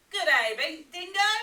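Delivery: a lightly voiced Platypus calling hello; Platypus greeting. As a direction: call out brightly as Platypus. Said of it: "he said, snapping his bill".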